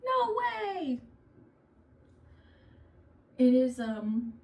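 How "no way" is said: very high, hammed-up child protest; imitating kids balking at mopping, very high; shocked kids. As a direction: squeal like a kid refusing chores. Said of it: "And they'd be like".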